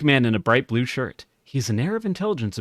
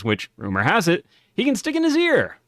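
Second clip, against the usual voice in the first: ordinary talk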